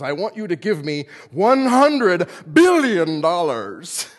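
slow, loud, menacing Doctor Evil demand; loud slow supervillain ransom demand; Doctor Evil impression. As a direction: drag out the ransom like a cartoon villain. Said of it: "Remember Doctor Evil?".

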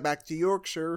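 imitating a shaky Yorkshire accent. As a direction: slip back into a mock Yorkshire accent. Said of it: "the quality of her Yorkshire accent".

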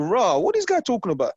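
high-pitched mock bewilderment; baffled disbelief. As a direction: act out being baffled, pitched right up. Baffled by the northern accent, retold.

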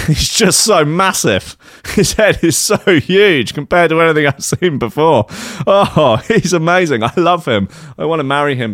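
high, giddy gushing with laughs. Acted. Podcast host quoting a smitten man, giddy. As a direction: gush over him giddily, laughing. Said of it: "I was giddy".

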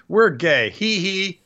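high, tittering mock-coy delivery; mock giggly announcement. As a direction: mimic a coy reveal with a fake titter. Mocks a coy on-screen announcement.

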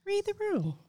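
high teasing sing-song Read the room. Shown as a demonstration. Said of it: "Just read the room".

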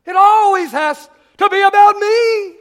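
loud, high, demanding egotist; self-centered person. Acted. First kind of person in the room, self-centered and demanding. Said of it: "The first kind of person".

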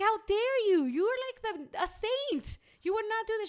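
mock outrage. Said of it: "And I'm like".

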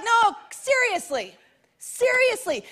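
high, slow, emphatic; pleading high-pitched insistence; insistent God. Pretend.

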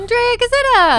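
excited. Acted. Intro name called out.

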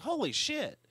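the penny drops all at once; stunned realization. Retold or imitated. Fan relives realizing who the secretary was, a high shocked yelp. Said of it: "I was like".

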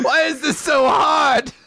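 high, drawn-out mock wail; frustrated shout. Kidnapper fighting buttons with knife, mock frustrated. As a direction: cry out in hammed-up exasperation.

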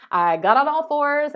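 ordinary delivery

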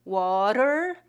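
"Water" slowly in American English, T flapped. Shown as a demonstration. Teacher comparing Portuguese R to English, American flap T. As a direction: say "Water" with a tapped T, rising. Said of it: "water em inglês americano".

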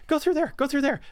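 high, jittery fae voice; squeaky, wound-up pixie; frantic tiny creature. Acted. Quickling voice.

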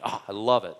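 ordinary talk

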